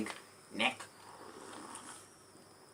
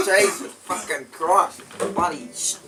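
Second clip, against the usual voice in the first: choking; 6 semitones higher, 6 dB louder, slower than usual. Real, not acted. Choking on the drink.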